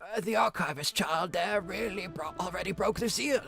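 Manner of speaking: put-on huffy old man bluffing; grumpy old man. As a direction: cover up like a proud old man. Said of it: "The old man voice of Ragnar".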